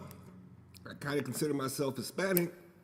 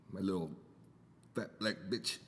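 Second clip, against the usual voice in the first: usual speaking voice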